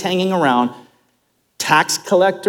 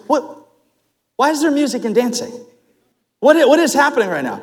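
indignant outburst; 9 semitones higher, faster than usual. Acted. Older son upset about party.

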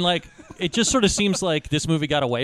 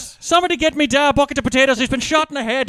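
higher, louder stage brogue; cartoon Irish accent; 8 semitones higher, 5 dB louder than usual. Riffing on the Irish accent, loud mock brogue. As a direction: moan it in thick mock Irish. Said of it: "god-awful accent".

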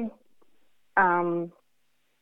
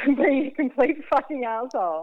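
laughing; 8 semitones higher than usual. Retold or imitated. Retold insult, laughing, high.

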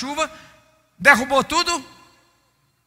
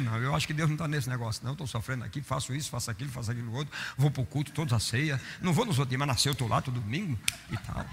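softer, fast mock moaning; whiny self-pity; 8 semitones lower, 8 dB quieter, faster than usual. Preacher mimics a believer ready to give up, softer, fast mock whining. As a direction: mimic a discouraged believer grumbling under breath. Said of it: "qualquer um de nós desistir".

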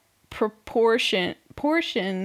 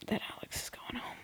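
hushed gossip whisper; whispered aside; 4 semitones higher, 18 dB quieter, faster than usual. Real, not acted. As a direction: drop to a secret whisper.

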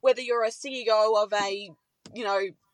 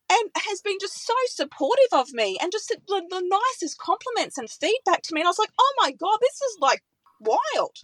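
higher-pitched, real emotion welling up; overwhelmed and moved, voice lifted high; getting teary; 7 semitones higher than usual. Real, not acted. Producer recalling her idol's praise, teary and overwhelmed. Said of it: "I'm getting teary now talking about it".

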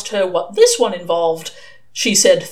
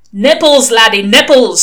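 testy; 2 semitones higher, 10 dB louder than usual. A narrator's reading. Old witchfinder to a baffled recruit, loud and testy. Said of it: "said the voice testily".

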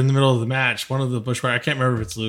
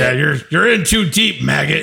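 mock drill sergeant; 3 semitones higher, 5 dB louder than usual.

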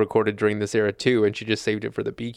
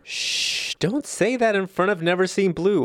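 mock hushing; 8 semitones higher than usual. Hushing so the song won't hear.

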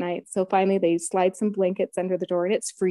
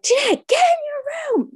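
high, loud yell telling her to get inside; shouted warning; 4 dB louder than usual. Retold or imitated. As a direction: shout it urgently at the newcomer. Everyone yelling at her.

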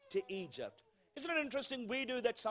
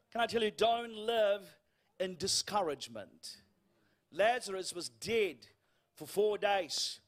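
ordinary talk, nothing marked

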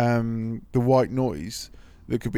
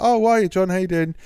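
higher, louder acted-out hello; enthusiastic greeting; 7 semitones higher, 4 dB louder, faster than usual. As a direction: hail a mate brightly, imitating him. Acting out Mark's pub greeting.

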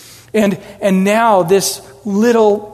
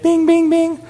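mock bing sound; 9 semitones higher than usual. Eyes getting big, sound effect.

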